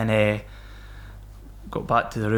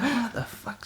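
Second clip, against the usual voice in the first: high, bewildered yelp; stunned disbelief; 13 semitones higher, 6 dB quieter, slower than usual. Retold or imitated. Himself at the police station, high and stunned. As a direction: caught out and can't believe it. His reaction to the accusation.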